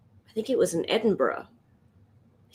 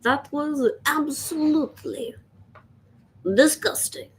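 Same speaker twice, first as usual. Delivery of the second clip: mock French accent; 8 semitones higher than usual. Riffing on a French vet, in a French accent. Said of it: "I've just been really having fun playing with accents".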